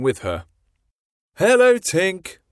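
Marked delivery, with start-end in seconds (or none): 1.3-2.4 high, louder happy greeting; excited boys calling. A narrator's reading. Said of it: "cried the boys".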